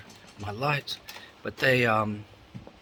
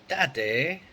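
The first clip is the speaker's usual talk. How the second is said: imitating his son's British "Daddy", higher and louder; a child's sing-song "Daddy" with British vowels; son's British accent; 6 semitones higher, 7 dB louder than usual. Dad voicing how his son calls him, in a mock British accent. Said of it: "he always messages me".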